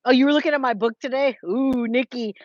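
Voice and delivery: ordinary talk, nothing marked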